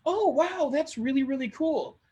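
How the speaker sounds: high wow of interest; impressed reaction. Acted. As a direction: sound genuinely impressed. Acted networking chat reactions.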